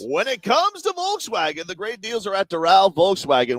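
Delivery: upbeat sponsor read. Acted. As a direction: sell it like a radio spot.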